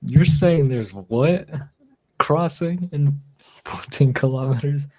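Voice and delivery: slow, high incredulous Aussie; Australian accent. Retold or imitated. Host imitating the man in the video, in a slow, high Australian accent. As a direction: stretch it out as a flabbergasted Australian. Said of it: "you can hear him just like".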